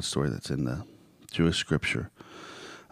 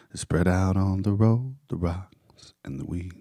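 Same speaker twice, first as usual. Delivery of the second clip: slow, swelling spoken-word recital; drawn-out lines of a declaimed poem; poetic recitation; 3 semitones higher, 4 dB louder, slower than usual. Acted. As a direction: declaim the verse, surging mid-way then easing down. Recites song lyrics to listeners.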